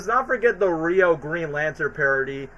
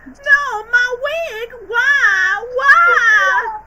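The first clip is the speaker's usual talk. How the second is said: very high, loud, crying falsetto diva; hammed-up diva wail; 9 dB louder, much slower than usual. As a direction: shriek it like a diva losing her wig. Playing Hannah Montana losing her wig.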